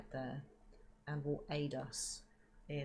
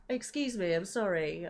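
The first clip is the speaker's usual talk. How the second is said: flustered apology; 4 semitones higher, 8 dB louder than usual. Real, not acted. Apologising for the sneeze.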